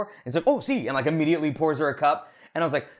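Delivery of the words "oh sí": eager waiter. Retold or imitated. Waiter instantly agrees.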